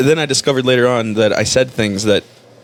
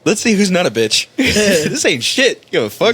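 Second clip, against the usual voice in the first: tag bravado; 7 semitones higher, slower than usual. Retold or imitated. Acting out bravado at the game.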